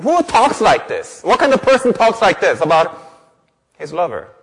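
high, emphatic rhetorical questions; forceful pulpit questioning; incredulous preaching. Real, not acted.

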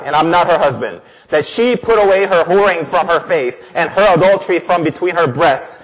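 relentless, forceful divine rebuke; angry God. Acted. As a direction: read it as a furious jealous husband's threat. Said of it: "Why is God so angry".

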